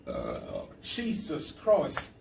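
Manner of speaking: faltering mock-British accent; put-on British accent. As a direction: struggle through a fake British accent. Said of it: "I gotta get out of this accent".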